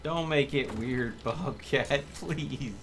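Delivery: ordinary delivery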